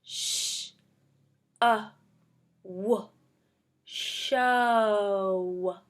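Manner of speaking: each sound stretched apart, then blended into show; stretchy snake sounding out. Shown as a demonstration. Teacher sounding out show for young readers, slowly. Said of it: "using our stretchy snake".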